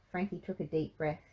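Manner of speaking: usual speaking voice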